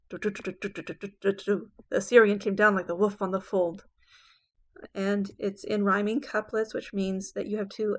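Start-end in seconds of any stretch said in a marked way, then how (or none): 0.1-1.7 rapid rhythmic "du-du-du" syllables; galloping hooves imitation. Shown as a demonstration. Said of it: "You can hear the galloping hooves". 1.9-3.9 "Assyrian came down like the wolf on the fold" in a loud anapestic gallop; galloping verse rhythm. Shown as a demonstration. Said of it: "galloping hooves when you read it out loud".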